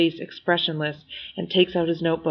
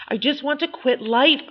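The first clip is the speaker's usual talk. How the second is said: raised, higher-pitched yell; lifted yell of frustration; 7 semitones higher than usual. A narrator's reading. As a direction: half-shout it in despair. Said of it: "I yell".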